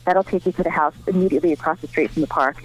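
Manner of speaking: ordinary talk, nothing marked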